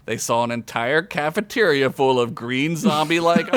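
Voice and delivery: usual speaking voice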